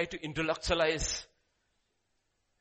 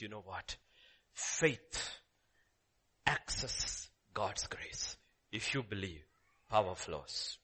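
soft, slow whispered secret; hushed whisper; 9 semitones lower, 6 dB quieter, slower than usual. Retold or imitated. Jesus speaking softly to the listeners, whispering. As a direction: breathe it like a secret.

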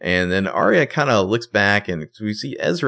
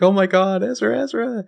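very high panicked cry; mock distraught; 13 semitones higher than usual. Arya crying out over Ezra, very high panicked mock voice. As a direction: repeat his name in panic. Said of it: "And Arya's like".

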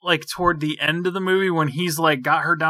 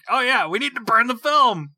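higher-pitched mock realization; mocking throwaway line; 7 semitones higher than usual. Mocking the professor's sudden conclusion, mock casual. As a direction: toss it out as a silly, high-voiced idea.

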